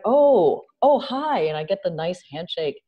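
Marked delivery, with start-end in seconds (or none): 0.0-1.4 high, louder, gushing hello; hammed-up patronizing welcome; gushing mock greeting. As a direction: play a lawyer greeting you with exaggerated sweetness. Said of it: "It's like".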